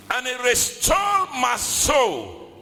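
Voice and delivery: shouted high declaration; preaching shout. Acted. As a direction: proclaim it with full force. Shouted scripture.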